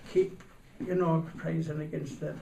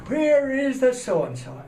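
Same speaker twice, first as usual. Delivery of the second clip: high, loud, slow roaring demand; furious shout; 11 semitones higher, 12 dB louder, slower than usual. Retold or imitated. Jealous husband storming up the stairs, roaring.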